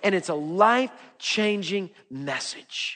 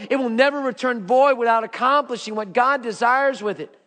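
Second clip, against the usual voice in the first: normal talking voice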